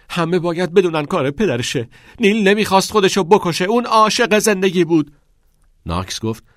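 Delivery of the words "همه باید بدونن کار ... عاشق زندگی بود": much higher, louder furious shout, speeding up. A narrator's reading. Grieving boy screaming blame at the father, furious shout. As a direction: yell it out in grief and rage. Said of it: "فریاد کشید".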